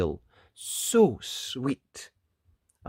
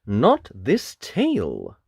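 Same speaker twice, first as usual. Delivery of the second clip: grinning; 7 dB louder, much slower than usual. Acted. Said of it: "the wolf grinned".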